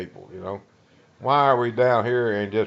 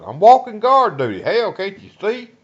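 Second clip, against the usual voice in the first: retold indignant retort; 11 semitones higher, 8 dB louder than usual. Guard answering, irritated.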